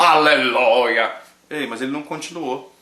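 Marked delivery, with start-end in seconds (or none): 0.0-1.1 drawn-out slow hallelujah in a put-on preacher voice; mock preacher hallelujah. Mimicking a seminar pastor, a slow hammed-up hallelujah. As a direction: stretch it out like a showy pastor. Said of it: "de repente ele falou assim".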